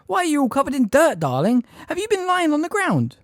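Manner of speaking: a hint of a fussy aunt; Aunt Petunia. A narrator's reading. Petunia voiced.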